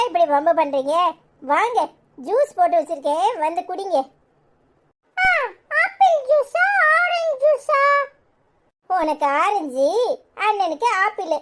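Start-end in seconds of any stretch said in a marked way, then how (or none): 5.2-7.8 put-on high, childlike chatter of a curious little kid; little kid asking about the baby; child voice. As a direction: play a small child pestering the family. Child asks about juice.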